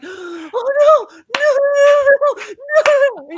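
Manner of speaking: high, loud mock-panicked cry of no; panicked wail. Wife hearing her own complaint on the podcast, panicked wail. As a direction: wail it like the world is ending. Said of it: "and I'm like".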